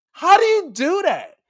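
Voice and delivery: high, loud incredulity; baffled outburst. Real, not acted. Fan baffled by wrestler spawning copies, loud and incredulous. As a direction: demand it in a high, astonished yell. Baffled rant at absurd storyline.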